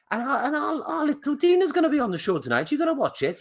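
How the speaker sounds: proud parent tells neighbours, high mock Welsh accent. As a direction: gush like a proud Welsh mum. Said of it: "that's her dad or mum".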